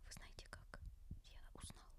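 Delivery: normal talking voice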